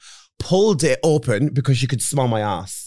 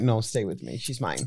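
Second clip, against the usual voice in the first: soft possessive; 5 semitones lower, 9 dB quieter than usual. Retold or imitated. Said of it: "I was like".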